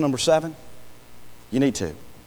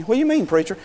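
questioning congregant; 7 semitones higher, faster than usual. Retold or imitated. Congregant voiced asking the preacher.

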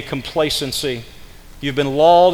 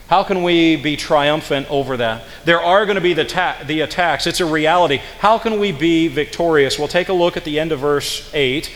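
normal talking voice

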